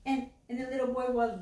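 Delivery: normal talking voice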